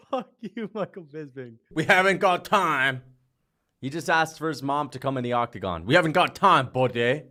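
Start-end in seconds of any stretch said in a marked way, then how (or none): none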